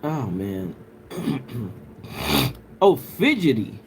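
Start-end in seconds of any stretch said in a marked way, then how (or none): none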